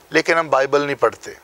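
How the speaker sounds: ordinary talk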